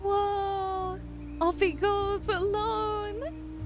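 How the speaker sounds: ordinary talk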